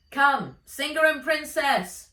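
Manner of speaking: calling out loud; shouted. A narrator's reading. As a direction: shout to wave them over. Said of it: "shouted Altan".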